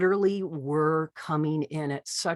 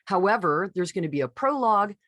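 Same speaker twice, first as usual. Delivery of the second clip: normal talking voice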